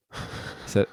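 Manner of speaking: normal talking voice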